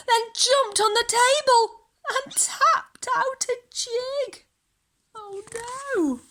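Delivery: high, excited storyteller; excited narration. A narrator's reading. Storyteller telling children of the dancing cow, high and excited. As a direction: pitch up for the cow's jig.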